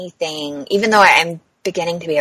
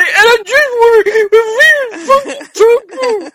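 mock sobbing meltdown; 4 dB louder than usual. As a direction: bawl it like a hungry, tearful child.

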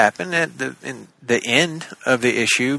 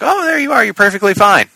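surprised; 9 semitones higher, 4 dB louder than usual. Retold or imitated. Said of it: "and I'm like".

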